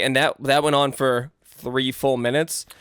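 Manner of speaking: usual speaking voice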